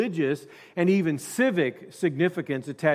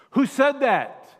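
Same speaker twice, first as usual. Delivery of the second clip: high, loud, slow furious roar; 10 semitones higher, 6 dB louder, slower than usual. A narrator's reading. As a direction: roar it at the crowd. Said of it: "roared Nikita Khrushchev".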